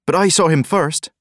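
high, loud and eager; eager insistence. A narrator's reading. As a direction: blurt it out to be first.

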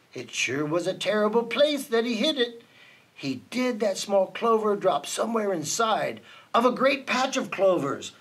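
plain reading voice

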